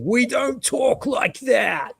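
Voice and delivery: high, slow, mocking New York accent. Woman on the street scolding him in a New York accent. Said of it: "she's talking exactly like that".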